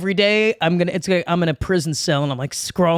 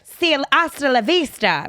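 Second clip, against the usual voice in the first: breezy goodbye; 7 semitones higher than usual. Pretend. Breezy exit in red convertible.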